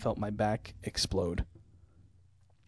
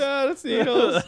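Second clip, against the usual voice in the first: laughing high quote; 13 semitones higher, 6 dB louder, much slower than usual. Retold or imitated.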